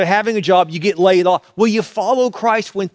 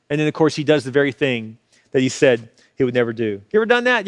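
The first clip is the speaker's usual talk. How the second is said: ordinary talk, nothing marked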